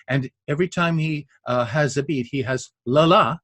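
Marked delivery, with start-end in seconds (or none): 2.8-3.4 "la, la" with the second la stressed; weak-strong stress. Shown as a demonstration. Showing listeners what an iamb is, weak-strong stress. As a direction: lean on the second "la", first one weak. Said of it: "which is to say weak strong".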